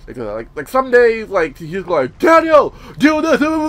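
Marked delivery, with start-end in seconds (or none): 2.2-3.7 high, loud mock dad yelling orders; mock yell of a bossy father; imitating shouting dad. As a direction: bark orders like a testosterone-fueled dad. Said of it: "he screams to the top of his lungs".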